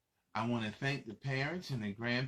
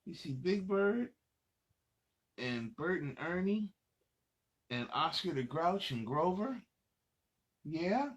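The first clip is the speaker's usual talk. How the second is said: put-on lively read-aloud for little kids; reading to children; 3 semitones higher, slower than usual. Adult reading a picture book to young children, lively storytelling. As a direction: read it out bright and animated for the kids.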